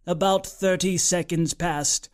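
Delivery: usual speaking voice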